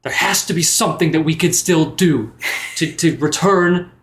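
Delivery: rally the grieving friend; impassioned resolve. Acted.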